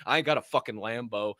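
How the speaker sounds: ordinary talk, nothing marked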